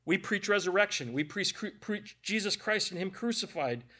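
usual speaking voice

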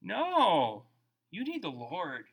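ordinary talk

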